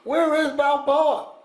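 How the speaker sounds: high, drawn-out imitated scream; Mister T screaming. Imitating a man in a huge hotel lobby, screaming. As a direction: holler it high and slow, like calling across a huge echoing space. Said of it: "Who is this guy screaming?".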